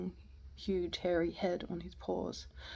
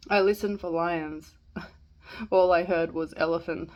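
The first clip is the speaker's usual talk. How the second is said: usual speaking voice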